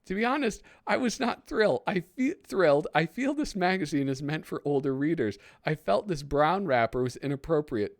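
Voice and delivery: high, loud prim complaining mother; upset parent. Acted. Reading a parent's complaint letter to Mad as a prim upset mother.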